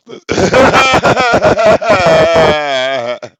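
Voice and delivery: loud, helpless cracking-up laugh; hard laugh. Real, not acted. Laughing at the absurd loan terms, loud helpless laugh.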